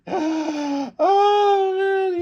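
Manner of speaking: gasp, then a high, loud oh man; sudden high gasp of disbelief; shocked gasp. Real, not acted. Fan stunned watching Okada flip off Takeshita, high gasp.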